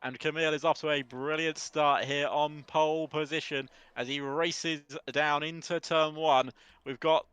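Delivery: lively play-by-play race call; race commentary. Acted. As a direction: call the race live, building excitement.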